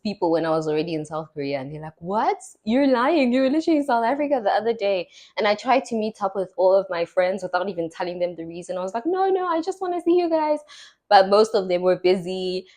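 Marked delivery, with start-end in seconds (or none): 2.0-5.1 high, fast mock disbelief; disbelieving friends. Friends disbelieve she is in Korea. 9.1-10.6 high, airy innocent dodge; breezy cover-up. Retold or imitated. Hiding the news from friends.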